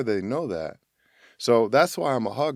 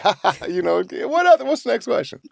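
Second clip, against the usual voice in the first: nervous laugh; 11 semitones higher, faster than usual. Retold or imitated. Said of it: "all that vulnerability came like".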